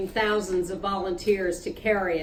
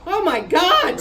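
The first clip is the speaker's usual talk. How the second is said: almost in tears; 7 semitones higher, 4 dB louder than usual. Acted. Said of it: "almost in tears".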